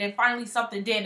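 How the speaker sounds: usual speaking voice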